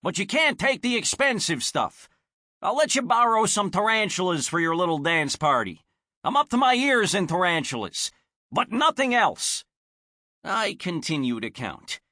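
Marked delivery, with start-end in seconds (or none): 0.0-9.6 louder, higher fed-up shout. Acted. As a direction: yell it through the door, exasperated. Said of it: "he shouts".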